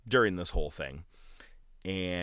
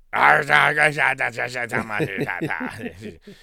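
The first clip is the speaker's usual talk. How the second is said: rapid nonsense babble, rising; gibberish yelling; 2 semitones higher, 4 dB louder than usual. Retold or imitated. Retelling Piper's gibberish, rapid rising babble. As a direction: yell random gibberish syllables. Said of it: "Piper speaking gibberish scene".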